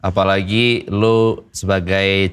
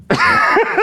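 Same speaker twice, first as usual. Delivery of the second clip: breathy laugh. Real, not acted. Dad breaks into laughter.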